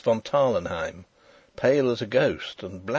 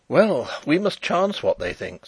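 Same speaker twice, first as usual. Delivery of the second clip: higher-pitched, mildly helpless reply; 6 semitones higher, 4 dB louder than usual. A narrator's reading. Fritz giving in to the risk, mildly helpless. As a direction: give in with a touch of helplessness. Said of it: "said Fritz helplessly".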